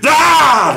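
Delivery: sudden high-pitched scream; imitated villain's random shriek; mock scream. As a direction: burst into a hammed-up yell. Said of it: "when he screamed at this".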